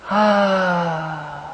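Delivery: loud breathy sigh out on "ah"; big audible relieved exhale; long exhale. Shown as a demonstration. Exhaling loudly after deep breath.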